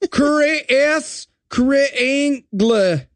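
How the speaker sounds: very slow, drawn-out reading; spelled-out slow read; sounding it out. Shown as a demonstration. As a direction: drag each syllable like a slow reader. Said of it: "sounding it out loud".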